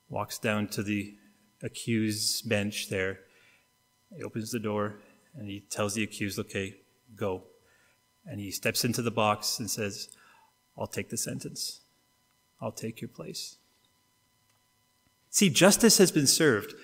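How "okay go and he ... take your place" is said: tender judge. Retold or imitated. Father judge frees his son.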